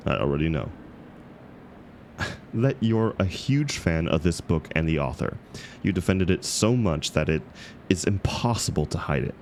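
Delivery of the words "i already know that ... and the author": usual speaking voice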